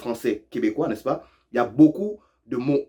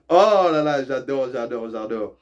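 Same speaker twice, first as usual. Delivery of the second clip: delighted; 3 dB louder than usual. Real, not acted.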